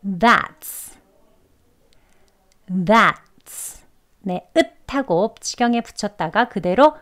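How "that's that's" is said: That's with stopped T into s; demo that's. Shown as a demonstration. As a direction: model That's for repeating. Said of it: "발음 같이 해보겠습니다". (따라 하기용 That's)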